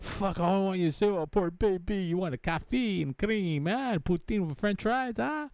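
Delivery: lightly hammed-up sweet talk; mock coddling. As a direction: coo like a doting partner. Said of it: "You're not even hearing him like".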